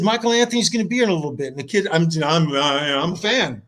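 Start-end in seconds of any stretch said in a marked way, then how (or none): 0.0-1.5 excited disbelief. Retold or imitated. Fan repeats news in shock.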